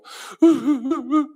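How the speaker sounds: wife's giggle. Retold or imitated. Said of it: "그러면 제 처가".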